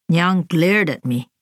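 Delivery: usual speaking voice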